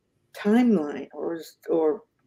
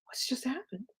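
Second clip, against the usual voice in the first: far softer, stunned and slack-jawed mumble; dazed drooling; 5 semitones higher, 18 dB quieter than usual. Retold or imitated. She stands stunned beside the landlady, mumbling in dazed disbelief. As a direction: mouth hanging open in disbelief, barely voiced. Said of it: "with the drooling going".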